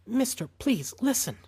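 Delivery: a woman begging the man holding a gun on her family; scared pleading. A narrator's reading. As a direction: beg him to listen, fear showing through. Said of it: "she just sounded scared".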